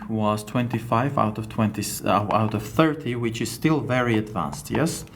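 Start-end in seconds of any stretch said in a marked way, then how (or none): none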